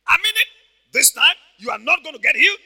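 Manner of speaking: high, loud devil's threat; acted insistent shout. Devil threatening sick Papa Hagin, acted insistent shout. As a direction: play an exasperated devil insisting.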